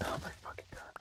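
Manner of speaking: whispered under the breath in disbelief; voiceless frustrated aside; exasperated whisper. Real, not acted. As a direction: breathe it out, fed up. Exasperated aside during game attack.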